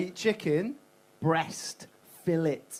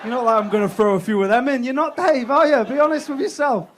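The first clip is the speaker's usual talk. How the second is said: loud rant; 8 semitones higher, 12 dB louder than usual. Real, not acted. Ranting at crowd about cooking.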